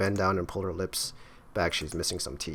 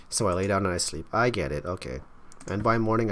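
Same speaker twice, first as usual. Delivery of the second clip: ordinary talk, nothing marked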